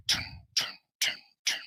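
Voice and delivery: voiced boot stomps on metal; boot steps. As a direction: tap out heavy footsteps with the mouth. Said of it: "You can hear his boots against the metal floor".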